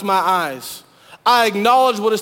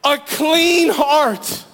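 clean heart stretched very slow and higher; pleading cry; 6 semitones higher, much slower than usual. Retold or imitated. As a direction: draw out "clean" as a longing plea. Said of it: "this cry to God".

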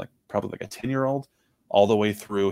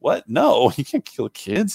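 loud, higher, drawn-out incredulous protest; shocked objection; 6 semitones higher, 6 dB louder, slower than usual. Retold or imitated. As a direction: act out an appalled, disbelieving refusal. Acting Cal's shocked objection to killing kids.

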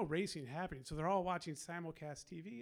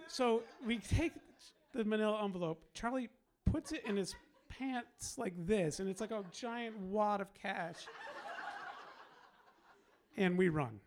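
excited, louder story climax with laughter; excited retelling; 3 semitones higher, 5 dB louder than usual. Real, not acted. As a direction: relive the getaway with rising excitement.

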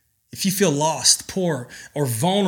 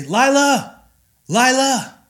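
much higher, sing-song call across the yard; 9 semitones higher, 3 dB louder, slower than usual. Shown as a demonstration. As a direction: call her name out as if searching for her. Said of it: "if I'm looking for her".

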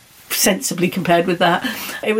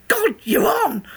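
delighted exclamation; 11 semitones higher, slower than usual. Retold or imitated. Lady accepts her offer.

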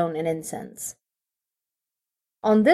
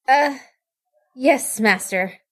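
sheepish; 5 semitones higher, slower than usual. Acted. Apprentice rebuked by master.

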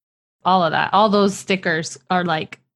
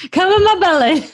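much higher and louder, cackling along; laughing mock cheer; 11 semitones higher, 10 dB louder than usual. Host joining the pantry joke, much higher and louder, laughing. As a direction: egg the binge on through laughter.

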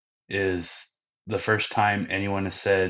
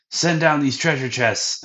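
raised, higher voice calling up; 7 semitones higher, 6 dB louder than usual. Retold or imitated. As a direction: call up to someone climbing above you. Gaimon calls up to Luffy.

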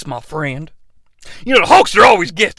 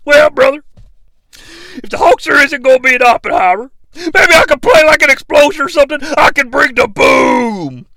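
loud, higher pumped-up pitch; Hulk Hogan; 8 semitones higher, 4 dB louder than usual. Acted. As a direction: sell the idea with wrestler bravado. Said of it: "Hulk Hogan".

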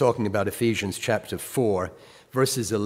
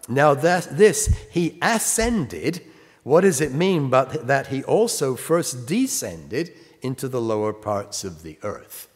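slow, higher, louder Bible reading; scripture reading; 4 semitones higher, 4 dB louder than usual. Acted. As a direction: lift each phrase, slowly and deliberately. Reads scripture aloud.

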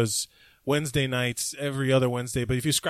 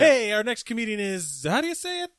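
announcer intro voice, higher; stage host voice; 8 semitones higher than usual. Retold or imitated. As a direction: introduce the next act like an MC. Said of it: "when I'm introducing someone on stage".

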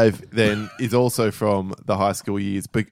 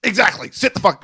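loud, raised mock-teacher retort; triumphant teacher bark; 11 semitones higher, 5 dB louder than usual. Imitating teacher dismissing caught pupil, loud stern retort. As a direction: cut the pupil down loudly.

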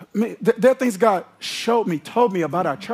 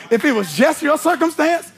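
full-force preaching; 6 semitones higher than usual. Acted. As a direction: preach it hard, building to a shout.

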